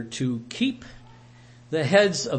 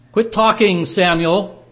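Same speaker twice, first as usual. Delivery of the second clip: higher and much louder, cutting him off; sharp rebuke; 6 semitones higher, 10 dB louder than usual. Acted. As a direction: shout him down. Samuel snapping at Saul.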